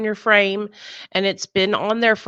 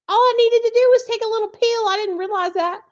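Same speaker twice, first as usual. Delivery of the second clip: excited relief; 13 semitones higher, 6 dB louder than usual. Real, not acted. Relief over blood pressure.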